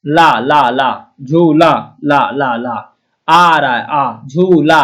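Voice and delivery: slow repeated ला, ला then आ; teacher sounding out the ending of झूला; syllable drill. Shown as a demonstration. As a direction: chant ला, ला slowly, landing on आ. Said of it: "क्योंकि ला प्रत्यय नहीं होता".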